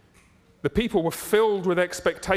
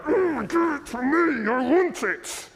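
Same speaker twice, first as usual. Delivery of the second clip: high, strained, drawn-out greedy wail; impatient, grasping Esau; 10 semitones higher, 4 dB louder, slower than usual. Acted. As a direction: wail for it like someone craving it now. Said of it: "His leaping is a, like, a".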